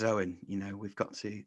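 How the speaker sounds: a lightly voiced cousin, barely changed from his own; half-hearted impression of the cousin inviting him over; pretend cousin voice. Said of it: "this is me pretending to be him".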